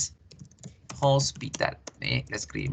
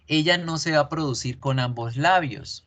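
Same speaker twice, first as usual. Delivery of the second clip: normal talking voice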